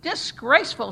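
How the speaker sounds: loud disgusted shout; crowd shouting. A narrator's reading. The crowd shouts its disgust at the church. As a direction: spit it out in loud disapproval. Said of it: "shouted the crowd".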